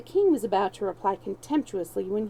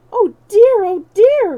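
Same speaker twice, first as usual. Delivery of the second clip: loud, high cry of alarm from a kindly stranger; loud high pitying cry; 11 semitones higher, 14 dB louder than usual. A narrator's reading. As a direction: call out in sudden pity, much higher. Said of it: "cried the benevolent stranger".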